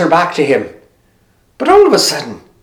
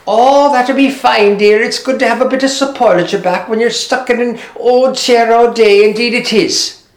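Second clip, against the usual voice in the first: put-on an old mother thanking her daughter; a lightly voiced old woman; old Agnes; 6 semitones higher than usual.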